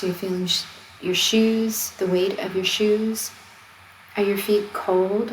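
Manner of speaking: ordinary talk, nothing marked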